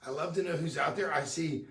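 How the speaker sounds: ordinary talk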